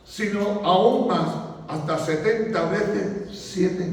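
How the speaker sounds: unhurried, weighty words of Jesus; slow preaching quote of Jesus; slow solemn reply. Retold or imitated. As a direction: let each phrase land slowly. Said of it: "Jesús le dijo".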